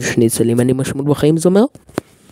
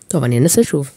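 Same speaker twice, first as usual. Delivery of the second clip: normal talking voice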